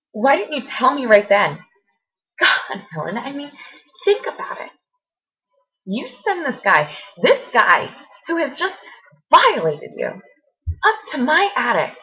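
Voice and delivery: high, emphatic outrage; angry disbelief. Acted. Confronting friend who sent attacker upstairs, outraged.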